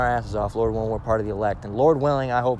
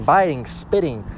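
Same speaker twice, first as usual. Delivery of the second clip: usual speaking voice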